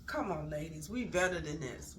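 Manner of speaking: ordinary delivery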